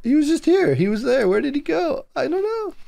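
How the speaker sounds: high-pitched, helpless confusion; mock bewildered. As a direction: play someone baffled at losing track. Voicing someone who lost Hunter.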